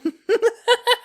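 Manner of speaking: shrill delighted cackle; high mocking cackle. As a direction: cackle at the empty threat. Laughs off police threat.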